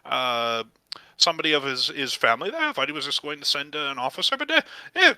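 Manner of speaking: very high, excitable gnome; high-pitched, flustered gnome delight; surprised gnome proprietor. Acted. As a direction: exclaim in astonishment, then ramble as a gnome. Gnome surprised to meet the nephew.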